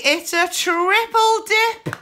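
high, loud, drawn-out delight; excited. Real, not acted. Scratching a card and finding a triple win, excited. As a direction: let the thrill lift the whole line.

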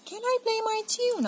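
put-on high, hopeful little boy; eager kid asking permission; child voice. Lester asks mum in the bath, high eager.

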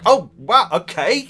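high, loud "oh wow" as if stunned; mock surprised gasp. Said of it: "was like".

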